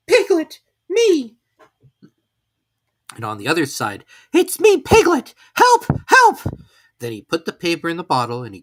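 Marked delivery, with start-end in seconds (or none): 0.1-1.4 small timid animal, much higher and louder; a tiny worried piglet wishing aloud. Acted. Piglet sighs to himself, stuck alone in the rain. 4.3-6.4 much higher, loud desperate cry; frantic Piglet calling for help. Acted. Piglet cries for help on his note, frantic and loud.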